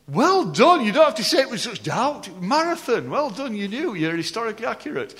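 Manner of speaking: high, loud, teasing congratulations; playful praise. Real, not acted. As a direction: cheer on the audience for getting it, voice swooping high.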